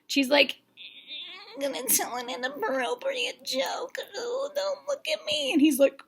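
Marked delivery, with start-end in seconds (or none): 1.5-5.5 tiny, very high soft voice; mock bashful contestant. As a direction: squeak it out like you want to hide. Imitates nervous contestant's awkward joke.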